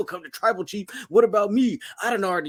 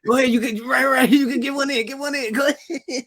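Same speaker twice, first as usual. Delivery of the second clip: higher, coaxing big sibling; big brother coaxing little brother, pitched up; coaxing kid; 5 semitones higher, 3 dB louder than usual. Retold or imitated. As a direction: urge him to hit back. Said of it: "that's when it's like".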